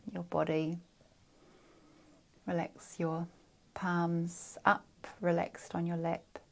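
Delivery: calm meditation instructions with long pauses; guided meditation. Real, not acted. Guide settling listeners' neck, shoulders and palms, calm spaced-out meditation voice. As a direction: ease each body part into relaxation.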